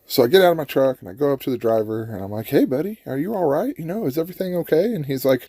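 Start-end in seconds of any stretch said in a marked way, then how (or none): 2.5-4.9 higher-pitched friendly hail; lightly acted, higher-pitched concern; concerned check-in. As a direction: call over to check on a stranger. Acted concerned check on stranded driver.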